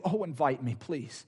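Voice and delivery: hushed, humble request voiced for another; gentle asking. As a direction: ask quietly, trailing to almost nothing. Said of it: "he's asking you".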